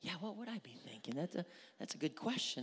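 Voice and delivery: soft, far-off mumble of a listener lost in thought; mock dazed listener. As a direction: lightly mimic someone stuck on a question. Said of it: "you're gonna be going".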